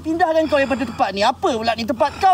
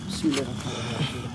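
low, hushed prayer under the breath; muttered bismillah; murmured prayer; 11 dB quieter than usual. Real, not acted. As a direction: murmur it as an invocation. Healer prays under breath.

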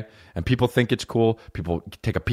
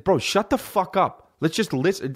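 higher-pitched exasperated telling-off; retold exasperation; 9 semitones higher than usual. Comic retelling scolding buddy about changing songs, exasperated. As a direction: lay down the law, fed up, as retold.

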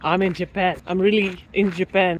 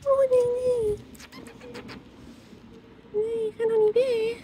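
pet talk; 13 semitones higher than usual. Real, not acted. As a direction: coax the cat not to leave. Begging the cat to stay.

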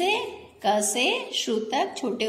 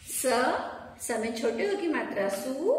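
normal talking voice

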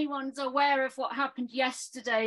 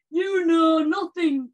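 slow, louder quoted line; Ygritte quote; 6 dB louder than usual. Retold or imitated. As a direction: drawl the famous line. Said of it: "Jon Snow".

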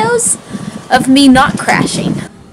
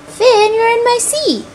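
high pet talk; 10 semitones higher than usual. Real, not acted. Talking to cat in seat.

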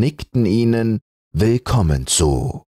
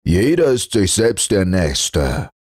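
lightly voiced grumble; 4 semitones higher than usual. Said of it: "brummte der Pelz in seinen Bart".

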